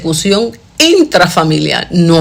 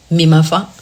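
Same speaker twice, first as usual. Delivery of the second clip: ordinary talk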